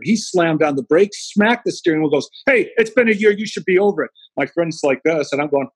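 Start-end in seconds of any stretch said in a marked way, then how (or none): 2.5-4.1 irritated driver. Retold or imitated. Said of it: "he got triggered".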